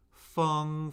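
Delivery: "Feng" held high and flat; first tone. Shown as a demonstration. Teacher cycling four tones on a syllable, "Feng" high and flat. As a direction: say "Feng" on a high, level pitch. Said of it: "Let's do the four tones".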